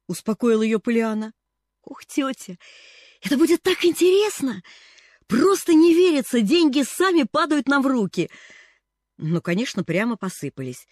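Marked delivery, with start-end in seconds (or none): 1.9-8.3 high, bright excitement; thrilled. A narrator's reading. Girl thrilled about the money plan, excited. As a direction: gush with delight.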